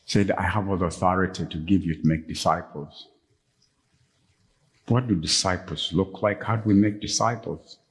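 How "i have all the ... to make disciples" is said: ordinary talk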